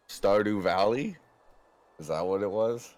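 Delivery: ordinary talk, nothing marked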